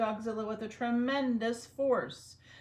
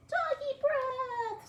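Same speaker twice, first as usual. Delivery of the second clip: very high, slow, loud squealing scream; screaming mice; 6 dB louder, much slower than usual. Acted. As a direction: shriek in panic like tiny mice. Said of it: "screamed the soldiers".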